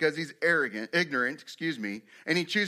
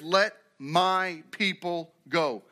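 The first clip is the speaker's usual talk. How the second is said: "my" held and set apart in a slow command; stress on MY; much slower than usual. Shown as a demonstration. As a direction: lean hard on "my", word by word. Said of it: "my" is extremely important there".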